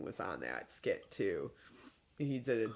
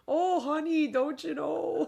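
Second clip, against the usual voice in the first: high, louder mock-Midwestern drawn-out lilt; goofy Midwestern accent; 13 semitones higher, 4 dB louder than usual. Joking that it isn't sexy, goofy Midwestern accent. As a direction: ham up a Midwestern accent, breaking into laughter. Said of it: "stupid Midwestern goofy accent".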